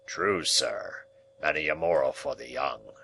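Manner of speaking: lightly voiced churchman. Churchman agreeing at the dinner table, low and solemn. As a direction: pronounce it like a pulpit moral.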